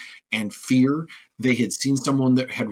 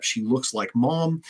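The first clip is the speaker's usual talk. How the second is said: ordinary talk, nothing marked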